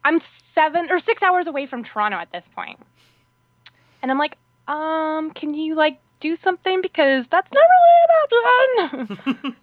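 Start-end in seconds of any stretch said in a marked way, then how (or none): 4.7-9.6 climbing into a high, drawn-out mock wail; retold pleading. Traveler stranded hours from Toronto begging the agent, mock pleading. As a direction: start tentative, end loud and squeaky. Pleading after entry denied.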